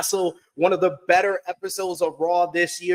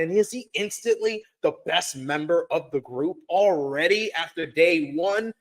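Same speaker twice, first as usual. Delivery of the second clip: normal talking voice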